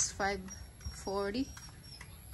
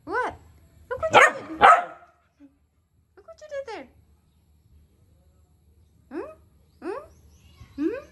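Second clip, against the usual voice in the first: high pet-scolding voice; 12 dB louder than usual. Real, not acted. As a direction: scold the dog high and shrill. Scolding the dog.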